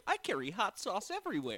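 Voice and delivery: mock Hillary Clinton. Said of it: "Is that where she's like".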